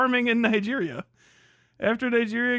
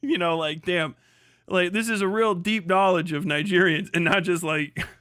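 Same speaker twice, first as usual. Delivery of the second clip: ordinary talk, nothing marked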